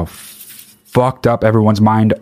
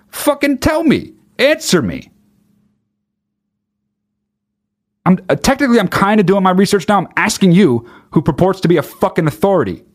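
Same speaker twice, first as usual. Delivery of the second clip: much higher, frustrated pleading; exasperated demand; 9 semitones higher than usual. Real, not acted. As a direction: push hard, voice climbing high. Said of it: "exasperated demand".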